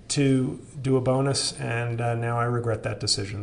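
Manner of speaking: sleepy voice trailing off; falling asleep. Real, not acted. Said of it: "I'm falling asleep, guys".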